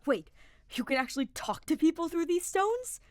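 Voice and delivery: high, loud, amazed disbelief; incredulous gasp. A narrator's reading. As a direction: gasp it out in wonder. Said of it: "Sylvain gasped incredulously".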